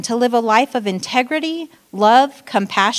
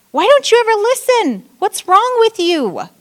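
much higher and louder, scolding; yelling at kids; 12 semitones higher, 5 dB louder than usual. Retold or imitated. Parent losing it at the kids, shouting. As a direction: blow up at a child who won't listen.

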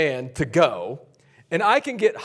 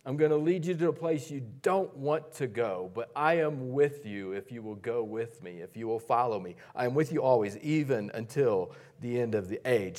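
lower, much softer, gentle promise; tender Jesus; 6 semitones lower, 8 dB quieter than usual. Acted. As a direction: speak softly like a loving Jesus. Jesus voiced tenderly to Peter.

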